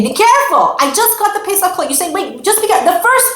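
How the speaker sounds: put-on frantic parent pleading with the child; fussing parent. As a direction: fret over the new holiday clothes. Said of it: "and you say to the kid".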